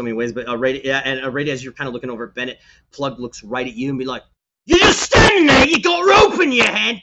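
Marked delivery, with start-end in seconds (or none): 4.7-7.0 high, loud screaming order; furious boss shout. Acted. Plug yells at the sailor holding the rope, furious shout. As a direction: scream him into moving.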